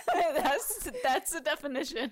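real laughter breaking into the words; laughing. Real, not acted. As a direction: try to get it out while laughing. Laughing while reading the definition.

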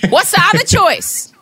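high, slowed incredulous retort; hammed-up exasperated question; mock exasperation. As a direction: throw it back in comic disbelief. Her exasperated reply to his catchphrase.

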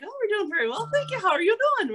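put-on high, lilting Irish accent; Irish brogue. Actresses in character answering lads at the bar, Irish brogue. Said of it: "we would talk in the brogue".

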